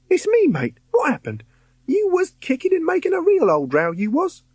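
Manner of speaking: put-on lively high Cockney boy's voice; chirpy London lad, cheeky; Cockney ghost boy.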